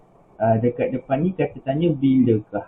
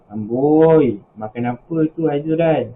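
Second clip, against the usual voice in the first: louder, drawn-out "Amboi" of teasing surprise; exclamatory intonation; 2 semitones higher, 4 dB louder, slower than usual. Shown as a demonstration. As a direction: demonstrate an exclamation with a loud, stretched opening. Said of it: "dia penuh dengan intonasi". (intonasi ayat seru)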